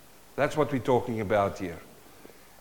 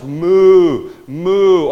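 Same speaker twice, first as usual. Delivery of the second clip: loud, drawn-out mock moo, raised pitch; big spoken cow impression; imitated cow moo; 6 semitones higher, 12 dB louder than usual. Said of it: "Or cows".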